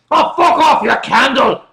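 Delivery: loud Glaswegian snap; Glaswegian insult. Shown as a demonstration. Streamer showing viewers a Glaswegian curse, loud Glaswegian. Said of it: "something a Glaswegian can say".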